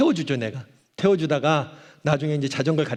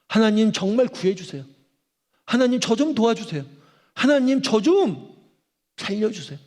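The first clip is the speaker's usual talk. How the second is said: pleading outcry; 6 semitones higher than usual. Pretend. Preacher modeling a prayer to God as a desperate plea. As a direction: cry out to God for help, slowing on the final plea. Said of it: "이렇게 소리 지른 적 있으세요?".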